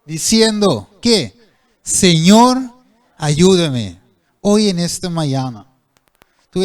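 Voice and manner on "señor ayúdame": Peter's loud plea, voice raised; retold cry for help. Preacher voicing sinking Peter crying to the Lord, loud plea. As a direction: call out loud for the Lord's help. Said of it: "Gritó".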